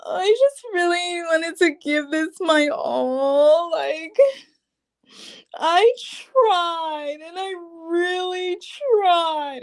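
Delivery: high, weepy, hammed-up sob; fake-tearful plea; mock crying. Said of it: "starts tearing up and saying".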